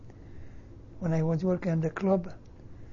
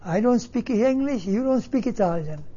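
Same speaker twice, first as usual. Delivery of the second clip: retold indignant retort, louder and higher; angry mother; 5 semitones higher, 6 dB louder than usual. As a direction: throw it back as a mad mother, loudly. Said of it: "She got mad".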